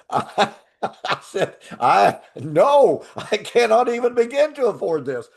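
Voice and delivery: high, laughing protest; laughing disbelief. Retold or imitated. Laughing retelling of refusal.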